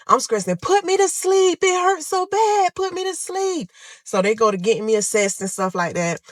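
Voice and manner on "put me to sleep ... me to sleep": crying plea. Retold or imitated. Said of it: "I'm screaming, I'm crying".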